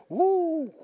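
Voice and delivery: a high, whooping woo; excited whoop. Real, not acted. Preacher whoops at his own point, excited. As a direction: whoop it up with delight. Preacher's exclamation after quote.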